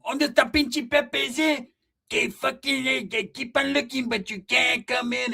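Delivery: a hint of a lost homie calling out. Pepe voiced, slow, high.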